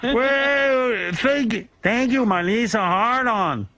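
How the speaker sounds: high, slow, badly faked Chilean accent; a comedian hams up a Chilean miner's accent; mock Chilean accent. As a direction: play a trapped Chilean miner in a hammy Chilean accent. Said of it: "Everybody sounds like they're from the Middle East".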